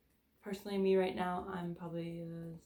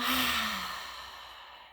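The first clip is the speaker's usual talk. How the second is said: slow audible sigh out through the mouth; long exhale sigh; 3 semitones higher than usual. Shown as a demonstration. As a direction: let the breath go with a long sigh. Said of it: "And exhale".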